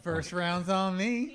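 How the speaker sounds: loud, very slow hearty offer; mock jovial buddy. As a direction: announce drinks like the friendliest guy at the bar. Joining the nice-guy mockery.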